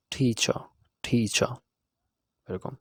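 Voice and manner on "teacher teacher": teacher ending in a plain a, the final r dropped; teacher without the r. Shown as a demonstration. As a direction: say teacher twice, no r at the end. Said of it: "আমরা উচ্চারণ করব".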